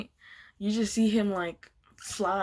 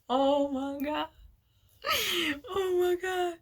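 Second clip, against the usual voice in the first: laughing disbelief; 9 semitones higher than usual. Retold or imitated. Everyone losing it laughing.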